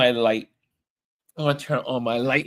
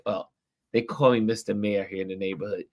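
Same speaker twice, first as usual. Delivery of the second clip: ordinary talk, nothing marked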